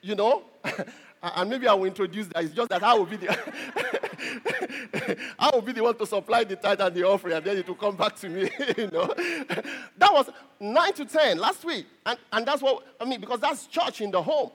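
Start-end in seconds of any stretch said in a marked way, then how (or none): 3.3-5.3 a quiet breathy chuckle at his own joke; soft chuckle. Real, not acted. Pastor chuckling at his own tithe joke to the congregation. Joking about tithe at home. 9.2-9.9 a short high-pitched giggle. Real, not acted. Pastor giggling at his joke about collecting tithe.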